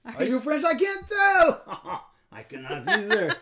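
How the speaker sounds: loud, hammed-up French accent with chuckles; exaggerated fake French; weird French voice. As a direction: play the cartoon Frenchman to the hilt. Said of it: "you get to talk in a weird voice".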